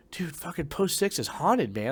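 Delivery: high, drawn-out insistence; retold freak-out. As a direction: relive the spooked confession, slow and high. Freaked out, tells fellow Marine.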